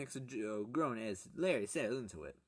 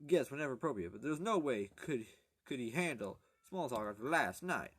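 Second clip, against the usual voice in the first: ordinary delivery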